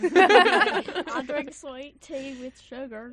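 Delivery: slow, loud Southern drawl; Southern country accent. Pretend. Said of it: "can you say the rest of this in a country accent".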